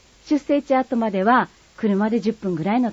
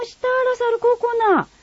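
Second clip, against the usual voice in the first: much higher, amazed Kagoshima dialect ラサール高校な with falling end; amazed exclamation; 14 semitones higher than usual. Acted. Friend amazed at the son's school, high astonished voice. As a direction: gasp out ラサール高校な in awe. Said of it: "短い会話を聞いてください".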